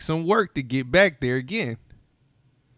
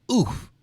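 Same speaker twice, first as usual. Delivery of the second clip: short high grunt of pain; a sharp oof as the knee hits; 7 semitones higher than usual. A narrator's reading. Narrator bumping knee, lets out a pained oof.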